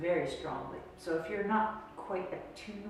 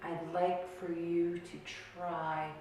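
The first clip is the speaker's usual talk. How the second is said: guided meditation; 7 dB quieter than usual. Shown as a demonstration. Instructor guiding listeners to feel the outer body, guided meditation. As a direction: ease them gently into a body scan, unhurried.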